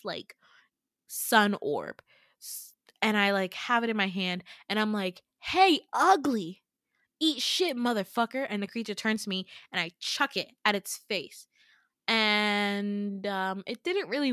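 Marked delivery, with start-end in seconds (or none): 5.4-8.5 taunt. Retold or imitated. Taunting the monster before throwing.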